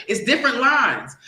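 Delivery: normal talking voice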